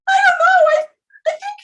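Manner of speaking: put-on high, loud, sobbing child's cry; retold crying wail. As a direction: wail it out like a tearful teen. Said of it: "And I was crying".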